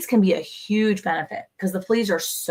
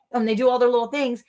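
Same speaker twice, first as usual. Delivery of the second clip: lightly voiced, higher guinea fowl chatter; a hint of a bird's voice, a little higher; bird accent; 4 semitones higher, faster than usual. Wife describing guineas, lightly doing the bird's voice. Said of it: "that was a closer accent for the bird".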